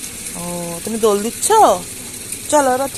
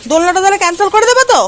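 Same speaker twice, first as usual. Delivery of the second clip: 1 mock threat; 6 semitones higher, 4 dB louder, much faster than usual. Real, not acted. Mock threat to cancel swing.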